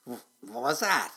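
loud stammered waking question; muttering dragon, startled. A narrator's reading. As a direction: splutter it out as a dragon jolted awake. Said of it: "He muttered".

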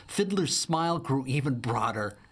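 ordinary delivery, nothing marked

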